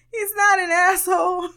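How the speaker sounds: high-pitched squeal on the edge of laughter; amused protest. Real, not acted. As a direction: protest way up high, about to crack up. Laughing protest in the baby's defence.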